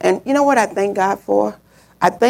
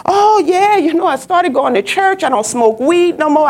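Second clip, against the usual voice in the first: imitating a happy girl, higher-pitched; joyous; 6 semitones higher than usual. Girl confides in boyfriend's mother, joyous. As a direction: chatter cheerfully like the girl. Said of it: "she was just joyous, happy".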